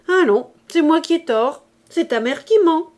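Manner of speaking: high, loud, offended retort; indignant mother. A narrator's reading. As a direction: snap back as a mother accused of lying. Mother quarrels with son. (mère outrée, ton haut et fort)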